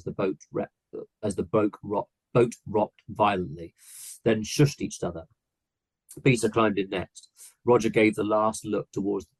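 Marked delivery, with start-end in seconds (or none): none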